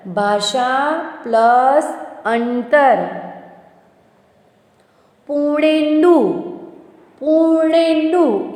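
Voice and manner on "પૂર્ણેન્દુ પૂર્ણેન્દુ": પૂર્ણેન્દુ stretched high to show the joined sound; sounded-out word. Shown as a demonstration. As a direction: hold each vowel of પૂર્ણેન્દુ. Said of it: "ઈનો ઉચ્ચાર થાય છે".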